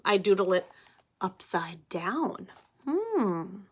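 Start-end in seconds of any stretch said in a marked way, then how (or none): none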